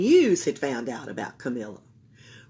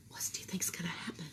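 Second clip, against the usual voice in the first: whisper; 12 dB quieter, much faster than usual. Real, not acted. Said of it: "asks listeners, whispered".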